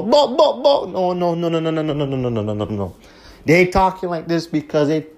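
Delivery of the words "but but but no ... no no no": high-pitched yelped buts, then a falling string of no's; mock sputtering protest. Host mocking people reacting to lyrics, high sputtering protest. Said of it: "people to bust for a blank and go".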